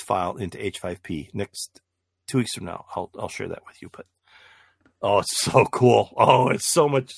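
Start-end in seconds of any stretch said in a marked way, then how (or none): 5.0-7.1 excited. Real, not acted. Enthusing about the new trick.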